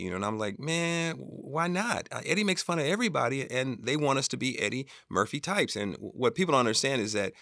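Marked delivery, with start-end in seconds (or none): 0.6-5.8 higher-pitched mild protest; retelling an argument, plead the case upward. Retold or imitated. Director arguing with Keenen over the scene, mild protest.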